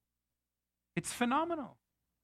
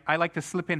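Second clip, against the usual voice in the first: ordinary delivery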